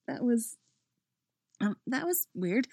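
awkward, self-conscious stammer; embarrassed. A narrator's reading. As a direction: stumble over it sheepishly. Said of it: "hides an embarrassed laugh".

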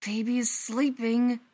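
hissed. A narrator's reading. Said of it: "he hisses".

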